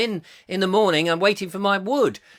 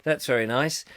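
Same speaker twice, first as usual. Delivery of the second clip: normal talking voice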